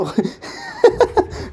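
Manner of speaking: a burst of high, unvoiced laughter; high breathy laugh. Real, not acted. Laughs at Romain's arm. (éclat de rire aigu)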